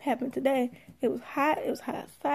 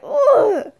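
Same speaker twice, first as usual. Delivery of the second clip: loud, high laughing ugh of disgust; 9 semitones higher, 11 dB louder than usual. Retold or imitated. Mom groaning at the pushy stranger, loud and laughing. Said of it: "But I didn't say that".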